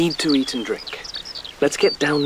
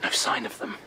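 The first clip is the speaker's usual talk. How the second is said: whispering, keeping hidden; breathy whispers so no one hears; hushed whisper; 7 dB quieter than usual. Acted. Waiting in hiding at night.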